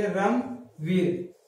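रमवीर stretched very slowly, every sound held; slow drawn out. Shown as a demonstration. Teacher spelling out a new word, very slow. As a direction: draw out रमवीर slowly for the students. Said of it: "इसको क्या पढ़ेंगे?".

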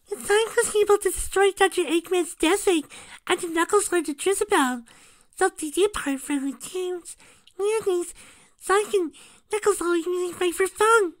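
extremely high-pitched, loud character voice; very high cartoon squeak; squeaky story character.

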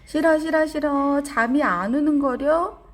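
put-on high, loud childlike whine of refusal; acted kid's loud protest; protesting child. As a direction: refuse bedtime like a stubborn kid, high and loud. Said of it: "친구가 뭐라고 말하나요?".

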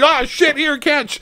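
clumsy operator. Pretend. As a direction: blurt it like a careless soldier fumbling the grenade. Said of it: "thrown from the bottom of the screen".

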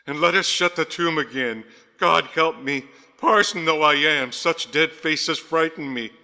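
high, loud, frightened pleading; gasped in fright. A narrator's reading. Rector begging to shut the tomb, loud frightened gasps. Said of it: "gasped Grant".

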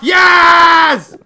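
high, loud victory yell; big high shout of joy; triumphant shout. Real, not acted. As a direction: cheer the win. Kills an Enderman.

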